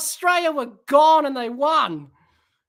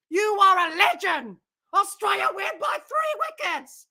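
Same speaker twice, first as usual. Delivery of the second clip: ordinary delivery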